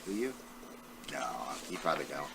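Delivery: usual speaking voice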